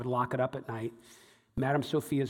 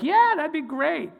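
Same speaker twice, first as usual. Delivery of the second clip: much higher, slow, forced cheerful yes; a sing-song polite yes hiding reluctance; fake polite enthusiasm; 13 semitones higher, 6 dB louder than usual. To the fortune teller, outwardly chirpy but reluctant. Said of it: "On, on the outside, I was like".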